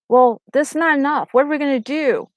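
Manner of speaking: a mild mock of the Israelites whining to go back; mock complaining. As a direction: grumble like an ungrateful crowd. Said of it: "they start complaining".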